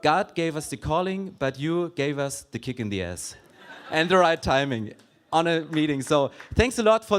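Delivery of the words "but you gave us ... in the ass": ordinary talk, nothing marked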